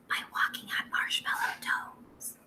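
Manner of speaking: breathy hushed whisper. Acted. Teacher hushing the class in the hallway, whispering. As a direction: whisper it like tiptoeing down the hall. Teacher asks for quiet walking.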